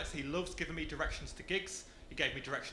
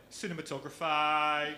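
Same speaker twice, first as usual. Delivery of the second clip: exaggerated Dudley accent, loud and drawn out; 5 dB louder, slower than usual. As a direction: stretch the final E into a broad Dudley AY. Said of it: "if a word would end in E".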